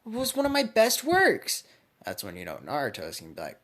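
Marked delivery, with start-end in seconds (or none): 0.1-1.6 high-pitched, louder indignant protest; outraged high voice, pushed up and loud; triggered outburst. Acted. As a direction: shriek at him for breaking her best blade. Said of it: "triggered by this".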